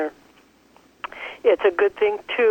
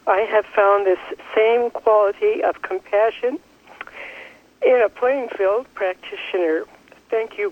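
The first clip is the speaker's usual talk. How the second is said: normal talking voice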